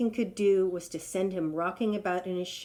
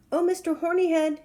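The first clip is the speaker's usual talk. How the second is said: high, louder call; 10 semitones higher, 6 dB louder than usual. Acted. Trapped lion calling to the rhino, high. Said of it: "he called".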